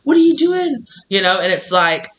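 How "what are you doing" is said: mock bystander. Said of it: "everybody's like".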